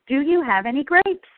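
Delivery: a hint of a pesky duck, rising question. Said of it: "the duck returns and again asks".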